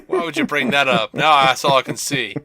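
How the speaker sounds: loud, high mock exclamation; hammed-up dismay; mock outrage. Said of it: "And I'm like".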